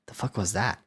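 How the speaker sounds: normal talking voice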